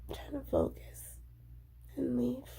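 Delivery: unvoiced whisper, holding back. Real, not acted. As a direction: whisper it so no one hears. Whispering venting alone.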